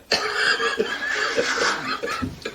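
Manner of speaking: soft, breathless laugh with no voice; breathy laugh. Acted. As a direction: laugh under the breath at your own joke. Laughing at own joke again.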